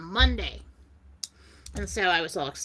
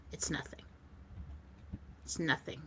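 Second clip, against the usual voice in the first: near-whisper, much lower and softer; hushed reassurance; 5 semitones lower, 17 dB quieter than usual. Retold or imitated. He softly tells her the burden is nothing, hushed. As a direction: brush it off very softly. Retells his soothing reply.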